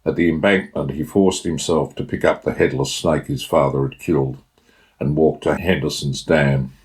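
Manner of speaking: ordinary delivery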